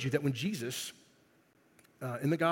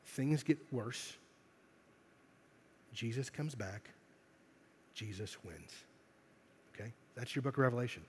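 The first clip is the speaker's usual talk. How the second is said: hushed summary; 5 semitones lower, 12 dB quieter than usual. Acted. Summing up Revelation, dropping down.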